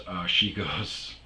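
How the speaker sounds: usual speaking voice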